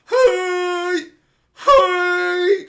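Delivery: very high, loud, drawn-out cry; wailing call. Acted. Viewer left lying on the floor calls after the show, mock wailing. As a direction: wail it out in hammed-up protest.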